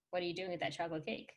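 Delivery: put-on soft, gentle suggestion to a child; role-played parent, quietly coaching; calm conversational parent. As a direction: show a child how to ask quietly. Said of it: "have a conversation with your child about it".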